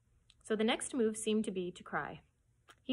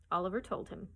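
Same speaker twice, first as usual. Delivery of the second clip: plain reading voice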